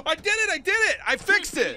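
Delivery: high, excited, loud celebration; triumphant cry. Real, not acted. Player who fixed the crashed game, triumphant and excited. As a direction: burst out in jubilant relief.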